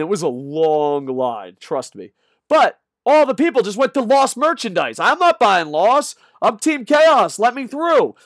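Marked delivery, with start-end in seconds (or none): 2.5-5.0 loud, high, worked-up complaint; excited rant. Real, not acted. Excited rant about merch line. 5.0-8.1 high, loud, pushing through a crowd; loud mock protest. Acting himself pushing through line.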